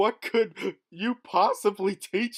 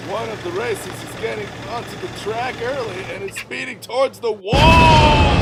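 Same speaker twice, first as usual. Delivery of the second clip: announcer shout; 5 semitones higher, 8 dB louder than usual. Acted. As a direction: yell the warning.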